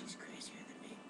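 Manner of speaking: hushed whispered aside; whispering. Real, not acted. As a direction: whisper it to the camera.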